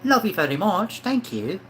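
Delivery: high-pitched put-on British accent. Thanking a chat viewer who loves it, British accent. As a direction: play up a chirpy British accent. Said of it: "you love the British accent".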